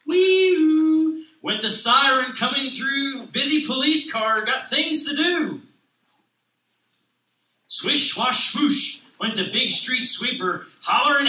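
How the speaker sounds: lively picture-book read-aloud with voiced characters; reading to children. Stay-at-home dad reading a children's book aloud, animated. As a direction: read it like storytime on the carpet.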